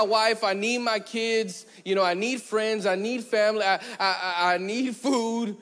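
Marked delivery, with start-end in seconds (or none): none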